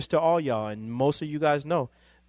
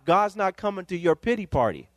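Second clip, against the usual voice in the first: ordinary talk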